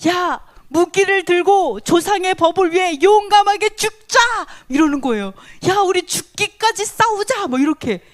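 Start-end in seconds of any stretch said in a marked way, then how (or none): 0.1-4.2 raised, high-pitched call to arms; bold rallying cry. Acted. Bold citizen urges townspeople to take up arms, rallying shout. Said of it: "담대한 시민이 뭐라고 하냐면". 5.7-7.5 high, defiant rallying call; bold battle cry. Acted. Bold citizen calls townspeople to fight to death, defiant cry. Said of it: "담대한 시민이 뭐라고 하냐면".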